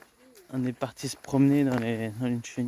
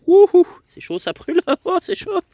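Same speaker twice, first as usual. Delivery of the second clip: surprised yelp; 6 dB louder than usual. Real, not acted. Grabbing burning hot bread.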